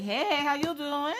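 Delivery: high, drawn-out singsong hello; mock-sweet slow hey; friendly greeting. Retelling her greeting back to technician.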